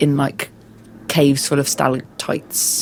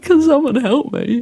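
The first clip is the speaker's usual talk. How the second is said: pained plea; 10 semitones higher, 3 dB louder than usual. Retold or imitated. Retelling stumbling downstairs in pain.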